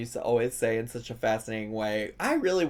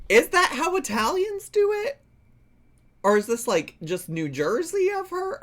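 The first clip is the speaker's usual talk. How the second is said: puzzled inner voice; 13 semitones higher than usual. Retold or imitated. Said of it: "I was just always like".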